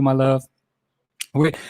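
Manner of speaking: ordinary talk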